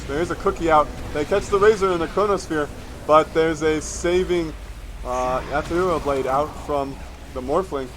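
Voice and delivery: excited esports caster calling the fight; live play-by-play. Acted. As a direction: call every move like a live caster. Live teamfight commentary.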